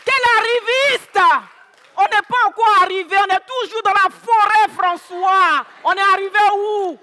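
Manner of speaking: loud, high shouted comeback; indignant retort. Acted. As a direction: shout back at your husband, outraged. Wife shouts back in quarrel.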